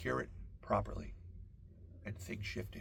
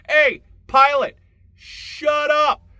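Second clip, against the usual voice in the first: much louder and higher, stretched out slow; yelling at pilot; 17 dB louder, much slower than usual. Retold or imitated. Passenger yelling up to the front at the pilot, full shout. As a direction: bellow up to the front of the plane. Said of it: "You yell up to the front".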